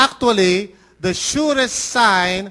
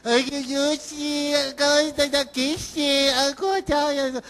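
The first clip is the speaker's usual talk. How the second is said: put-on high, soft, slow baby-talk coo; adult cooing baby talk to a small child; 6 semitones higher, 4 dB quieter, much slower than usual. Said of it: "how do you talk?".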